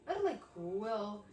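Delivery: ordinary delivery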